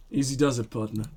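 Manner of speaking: low, soft steadying murmur; steadying lieutenant. Acted. Cautioning a partner who is taking aim, steadying. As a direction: keep it low and controlled. Voices lieutenant before the shot.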